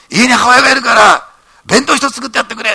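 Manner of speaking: high, loud scolding bark at Ito; boss shouting. Retold or imitated. Company president shouting at young Ito at the banquet, loud and high.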